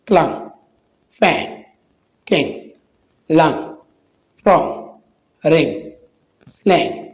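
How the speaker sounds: word list with NG said as a nasal n; NG as "noon". Shown as a demonstration. Teacher drilling NG words for viewers, nasal n sound. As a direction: say each word alone, ending NG as in "नून". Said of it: "नून का आवाज़ देता है".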